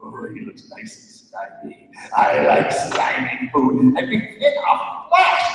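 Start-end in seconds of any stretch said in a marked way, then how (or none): 0.0-4.0 hungry dog. Acted. Dog eyeing the alien as food, greedy relish. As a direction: relish the slimy meal. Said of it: "Goku the dog said".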